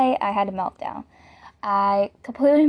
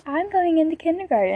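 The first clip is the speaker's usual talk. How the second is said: imitating a kid; 7 semitones higher, faster than usual. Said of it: "They're like".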